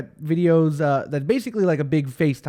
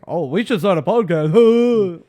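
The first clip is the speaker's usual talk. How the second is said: slow, louder mock of the tired joke; mocking the cliché; 6 dB louder, slower than usual. Mocking the podcast cliché to his co-host, slow and loud. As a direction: draw out the same old podcast joke. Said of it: "that's the first thing I say".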